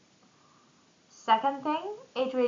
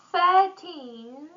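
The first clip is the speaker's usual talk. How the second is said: put-on "Thirteen" with the stress on the end, TEEN; thir-TEEN, hit the last syllable; 6 semitones higher, 4 dB louder than usual. Shown as a demonstration. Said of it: "stress on the end".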